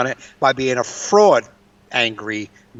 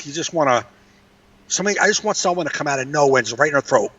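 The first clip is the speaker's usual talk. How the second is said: ordinary talk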